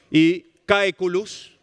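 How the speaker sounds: caeculus slow and careful, ca-e-cu-lus; Latin word. Shown as a demonstration. Teacher explaining etymology, says caeculus slowly. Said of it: "que es cieguito".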